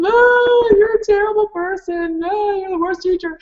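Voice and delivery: high mock bawling; child really crying. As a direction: wail it like an inconsolable kid. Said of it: "Now they're kind of really crying".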